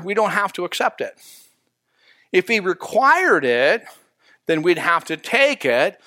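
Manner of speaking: ordinary talk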